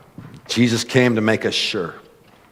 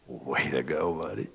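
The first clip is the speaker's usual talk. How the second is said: tender; 6 semitones lower, 10 dB quieter than usual. Retold or imitated. Father praising Jesus.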